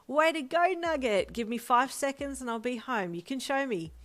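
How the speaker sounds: loud, high, delighted father; excited dad. A narrator's reading. Father cheers his son over the phone, loud and excited. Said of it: "replied excitedly".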